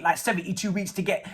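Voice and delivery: normal talking voice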